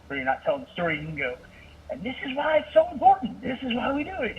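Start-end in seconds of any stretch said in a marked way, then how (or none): 1.9-4.4 high-pitched fervent sermon exclamation; really amp it up like at the pulpit; impassioned pastor demo; preacher overdrive. Shown as a demonstration. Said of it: "kinda go into overdrive at certain moments".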